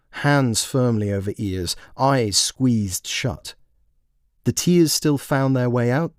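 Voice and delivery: ordinary delivery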